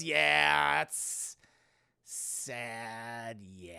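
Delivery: put-on sorrowful commiseration; mock sympathy. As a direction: stretch it out in fake condolence. Acting David's mock sympathy.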